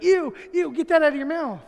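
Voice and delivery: high, loud mimicked revulsion; disgusted mother. Wife scolding son over old gum, disgusted. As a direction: imitate a mom recoiling and ordering it out.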